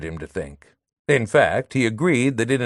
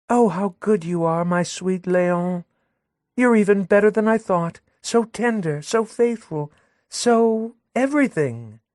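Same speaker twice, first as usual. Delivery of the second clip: put-on higher sweet young woman with a barbed edge; Madelon, sweet and sly; 10 semitones higher than usual. Madelon cooing at Léon while jabbing the narrator, higher sweet voice. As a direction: coo at him, then slip in a dig.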